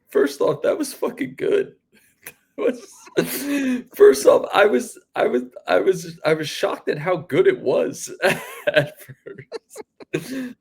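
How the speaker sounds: high, laughing praise with a breathless giggle; laughing with delight. Real, not acted. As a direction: gush through laughter.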